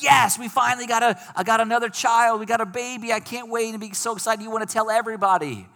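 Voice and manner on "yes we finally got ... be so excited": much higher, bubbling mock joy of a new parent; excited new parent. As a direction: play a thrilled parent, pitched up high and bursting. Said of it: "Wouldn't you be excited about that?".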